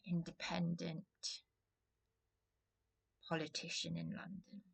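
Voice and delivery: drops to a breathy whisper on the name. Real, not acted. Hushing it while naming a London politician, whispered. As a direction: whisper the word like a secret.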